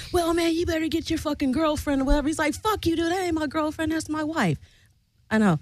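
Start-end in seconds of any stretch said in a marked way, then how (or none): 0.1-2.3 high, fast put-on griping; mocked arguing dude. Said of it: "the dude is still like". 2.5-4.6 high-pitched mock indignation, no shouting; retold indignant retort. Acting out Pat's angry retort.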